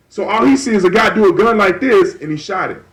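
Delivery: normal talking voice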